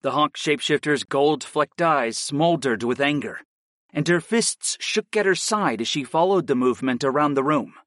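usual speaking voice

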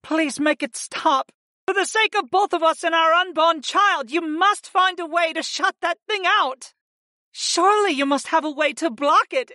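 high, distraught female voice; high-pitched urgent begging; desperate, near sobs. A narrator's reading. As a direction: implore him for mercy. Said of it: "cut through the sound of sobs".

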